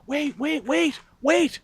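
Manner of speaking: high-pitched pleading mom; imitating a flustered mother; urgent calling back. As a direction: call after someone leaving, voice way up. Mom calling him back, retold.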